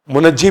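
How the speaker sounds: "मुनज्जी" with an added vowel after the न; variant pronunciation. Shown as a demonstration. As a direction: say "मुनज्जी" as mu-naj-jee, three syllables. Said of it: "भी लोग कहते हैं". ("मुनज्जी" को तीन हिस्सों में, मु-नज्-जी बोलें)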